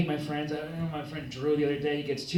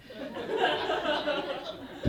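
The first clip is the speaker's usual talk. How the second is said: high, giggly chuckle; giggle at own joke; 13 semitones higher, 6 dB quieter than usual. Pretend. Comic giggling at his own notebook joke. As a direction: giggle like you find yourself hilarious.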